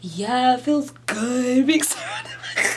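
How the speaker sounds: mocking her own gushing; laughing mock gush. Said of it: "I just realized I'm over here like".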